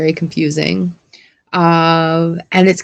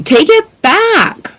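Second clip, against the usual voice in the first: teasing demand; 11 semitones higher, much slower than usual. Real, not acted. Playful high demand.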